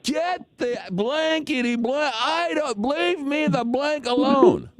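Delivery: very high, stretched-out imitated tirade; mock yelling. Umpire yelling at a fan in a hushed bar, mock tirade. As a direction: parody an umpire screaming himself hoarse. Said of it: "as he's yelling at me".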